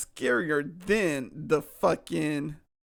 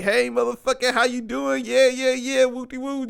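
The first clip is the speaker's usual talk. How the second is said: higher, louder hype-man greeting; higher and louder, casual hype greeting; buddies greeting; 7 semitones higher, 4 dB louder than usual. Retold or imitated. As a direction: play two pals hyping each other up. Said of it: "together and was like".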